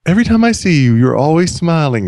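high, sing-song gentle voice; melodic voice. Retold or imitated. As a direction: lift it up high and let it sing. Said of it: "His voice was melodic, just like this".

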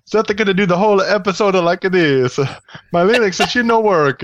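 high, hammed-up fake Italian; cartoonish Italian accent with added vowels; horrible Italian accent. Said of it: "Seth could do a horrible Italian accent".

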